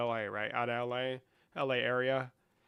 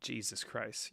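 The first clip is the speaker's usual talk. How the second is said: ordinary talk, nothing marked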